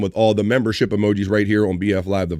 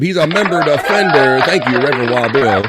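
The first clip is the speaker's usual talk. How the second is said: hype call; 3 semitones higher, 4 dB louder than usual. Real, not acted. Host hypes the donor who gifted a membership, announcer-style hype call.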